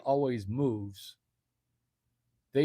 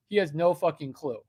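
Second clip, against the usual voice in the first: usual speaking voice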